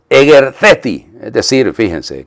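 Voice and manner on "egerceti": firm, raised voice, higher and louder; strong, commanding Greek imperative; forceful command. Acted. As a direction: call out the order with force. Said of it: "le dijo con fuerza".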